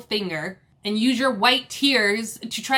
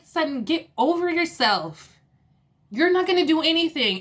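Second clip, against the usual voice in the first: exasperated telling-off; 6 semitones higher than usual. Retold or imitated. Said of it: "And I'm just like".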